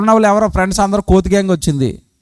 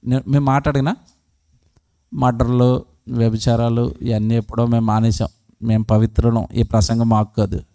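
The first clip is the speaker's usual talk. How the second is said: mock self-righteous congregation; 11 semitones lower, 4 dB quieter than usual. The preacher mimics churchgoers who think the sermon isn't for them, lower and smug. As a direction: lower and softer, smug and pious.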